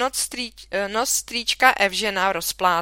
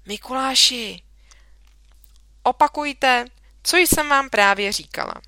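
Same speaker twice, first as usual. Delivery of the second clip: sharp call; 4 semitones higher, 4 dB louder than usual. A narrator's reading. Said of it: "zvolala paní učitelka".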